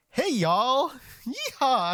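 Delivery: high, loud hammed-up Southern drawl; mock Southern accent. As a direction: holler it like a caricature Southerner. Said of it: "if the Southern accent was a thing".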